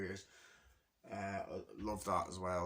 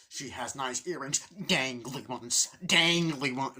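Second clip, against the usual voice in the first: put-on old man quoting; 3 semitones higher, 7 dB louder, slower than usual. Old man on plane remarks on earrings, slow then louder. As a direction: savour the repeated dangly ones. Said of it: "when he says that in that film".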